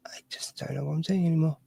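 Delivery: whispering to himself, lost; whispered aside. Real, not acted. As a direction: breathe it out like a private confession. Muttered aside while fumbling.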